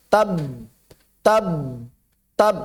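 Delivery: "Tab" cut off with lips closed; held, unreleased b. Shown as a demonstration. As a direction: stop "Tab" on the b without letting it go. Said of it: "tertahan di makhraj".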